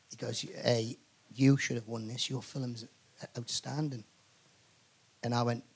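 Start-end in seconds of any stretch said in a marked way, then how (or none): 0.4-4.0 low, hushed praise; low confidential aside. Retold or imitated. Rival nominee praising his film privately, low and hushed. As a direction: imitate a man quietly offering a compliment. Quoting man who came up to him.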